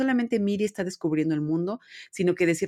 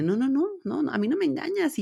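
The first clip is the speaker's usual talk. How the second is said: much higher, singsong no no no; disbelieving dad; 10 semitones higher than usual. Retold or imitated. Dad refusing to believe his daughter, in a high singsong voice. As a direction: play the dad who won't be fooled. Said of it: "Y él le dice".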